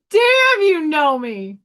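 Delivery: high, loud delighted burst, laughing at the end; excited exclamation. Retold or imitated. Retelling the lineman guessing Staten Island, high delighted shout. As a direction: shriek it out, thrilled he caught it.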